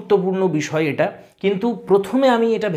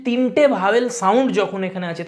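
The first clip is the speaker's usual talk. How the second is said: ordinary delivery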